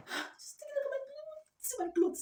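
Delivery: high, wailing half-sob; mock crying. Real, not acted. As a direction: wail at the ruined clothes. Crying over ruined clothes.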